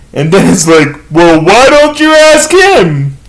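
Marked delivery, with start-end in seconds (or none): 1.1-3.2 high, slow mock-offended comeback; pointed retort. Offended family answers the waitress, pointed retort. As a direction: draw it out high as an acted retort.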